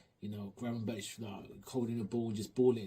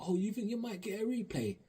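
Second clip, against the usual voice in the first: mocking taunt; 10 semitones higher than usual. Taunting rival fans.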